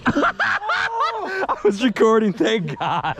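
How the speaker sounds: high, breaking into laughter; can't stop laughing while talking. Real, not acted. Laughing at the lost skateboard.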